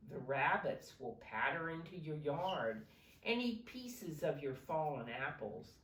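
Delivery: plain reading voice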